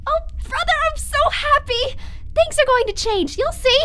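bursting with hope; elated. Acted. Elated promise.

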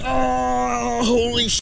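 long, high cry, cut off at the end; straining amazement. Real, not acted. Angler hauling the monster fish aboard, straining amazement. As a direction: hold the cry high, then break off.

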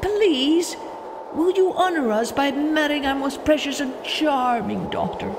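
Mole's eager plea. Acted. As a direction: beg politely. Mole pleads with wind.

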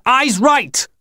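very high urgent command; yelled. A narrator's reading. Child orders the others to glare, urgent high yell. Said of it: "I yelled".

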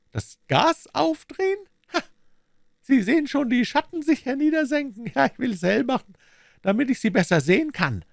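high, thin pinched voice for the villain; sly villain in a high falsetto; Smith's high voice. Acted. Smith gloats over dying Holmes.

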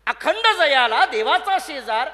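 loud, high, drawn-out challenge; ringing reproach, slow and forceful; stern rebuke. Acted. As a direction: fire the question out as a scolding. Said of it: "मुक्ताबाईंनी खडसावलं".

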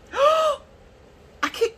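high breathless squeal of delight; overwhelmed squeal. Real, not acted. Overwhelmed by colours.

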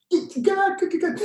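high delighted praise; excited cheering. Real, not acted. Praising audience clicking again, excited cheer.

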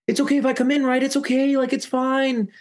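very high, whiny mock pleading; high, put-on wheedling voice; imitated pleading Annie. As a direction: imitate someone begging to be let in. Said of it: "So Annie goes".

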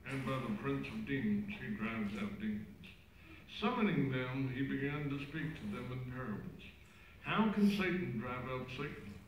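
cathedral echo. Real, not acted. Gospel read far off.

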